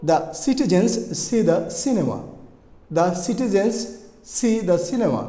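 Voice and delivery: word by word with lengthened s sounds; very slow, falling away on "cinema"; slow example sentence. Shown as a demonstration. Teacher gives a C practice sentence, slow and deliberate. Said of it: "একটা example".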